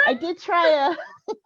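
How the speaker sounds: ordinary talk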